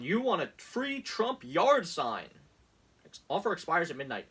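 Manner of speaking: sarcastic infomercial voice; mock ad read. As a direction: drag it out like a cheesy offer. Reading Trump campaign text aloud.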